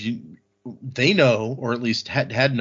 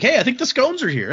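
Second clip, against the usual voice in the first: delighted; 13 semitones higher, 4 dB louder than usual. Retold or imitated. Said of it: "And then Wayne's like".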